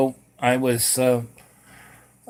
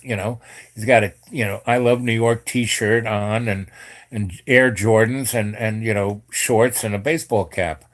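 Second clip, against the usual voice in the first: ordinary delivery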